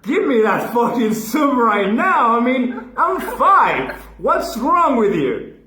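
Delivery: put-on a five-year-old blowing up at his mom, half laughing; outraged kid. Comic to the crowd, outraged laughing shout.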